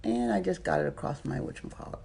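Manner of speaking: talking with a retainer in; wearing a retainer. Real, not acted. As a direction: retainer in the mouth. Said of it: "I have my retainers in still".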